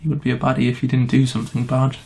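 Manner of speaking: usual speaking voice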